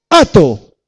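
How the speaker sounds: a sudden loud, high cry of "ato"; commanding shout. Acted. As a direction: bind it with a fierce commanding cry. Binding the strong man in prayer.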